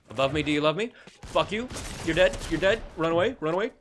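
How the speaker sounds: playful panicked shouting; frantic panic. Real, not acted. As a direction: shout it like you're in a full frenzy. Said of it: "I'm panicking now".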